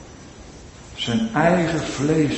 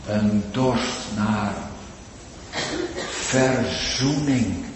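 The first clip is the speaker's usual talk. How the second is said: usual speaking voice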